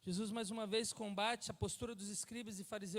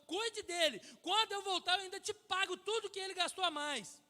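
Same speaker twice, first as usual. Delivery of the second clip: forceful preaching; 5 semitones higher, 6 dB louder than usual. Real, not acted. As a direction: tell the parable with mounting fervor.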